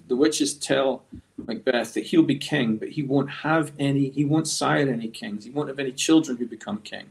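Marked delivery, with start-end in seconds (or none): none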